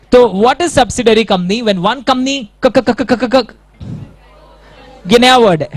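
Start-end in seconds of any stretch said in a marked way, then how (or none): 2.6-3.5 stuttering on क over and over; mock stammer. Teacher faking a student stuck on the word, mock stammer. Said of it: "घटिया acting".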